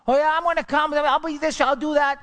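high, loud mock-eager promises; mocking promiser. Preacher mocking people who promise but never do, high mock eagerness. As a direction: lampoon the big talker.

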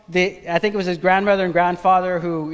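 ordinary delivery

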